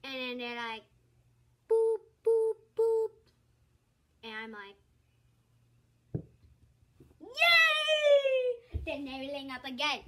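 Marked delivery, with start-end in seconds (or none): 1.7-3.1 high, loud beeping boops; imitated hang-up beeps. Girl imitating the phone line hanging up, high boops. 7.2-8.8 high, loud triumphant cheer. Retold or imitated. Girl cheering when the spam caller hangs up, loud.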